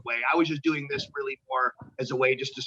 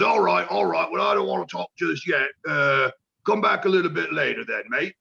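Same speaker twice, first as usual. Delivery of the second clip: a British veteran brushing him off, voiced higher and louder; gruff British veteran; 3 semitones higher, 4 dB louder than usual. Veteran wrestler brushing off eager rookie, mock British accent. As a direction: play the gruff old pro waving the kid away. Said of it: "And he just kinda goes".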